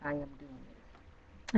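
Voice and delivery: soft, very slow and deliberate; hushed slow self-affirmation; quiet affirmation. Shown as a demonstration. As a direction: say it low and certain, word by word.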